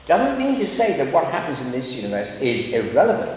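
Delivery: usual speaking voice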